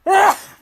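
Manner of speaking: sudden sharp sneeze; real sneeze out loud. Real, not acted. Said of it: "I'm gonna sneeze here".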